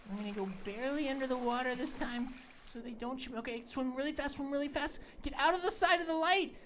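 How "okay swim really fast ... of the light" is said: normal talking voice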